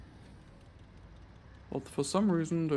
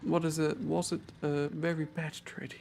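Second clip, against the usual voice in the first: ordinary talk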